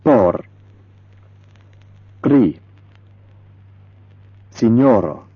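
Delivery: Por, Kri, Signoro with a rolled R. Shown as a demonstration. Teacher showing learners a rolled Esperanto R. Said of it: "like this".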